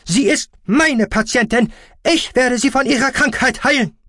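high, loud, possessive shout; strident, furious doctor; screaming. Acted. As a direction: insist at full volume. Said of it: "schrie Aspartamin".

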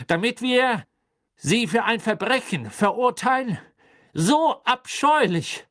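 loud, high, forceful judge's declaration; clear voice. A narrator's reading. Crouch states the charge in court, loud and clear. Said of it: "sagte er mit klarer Stimme".

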